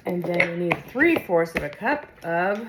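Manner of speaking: ordinary talk, nothing marked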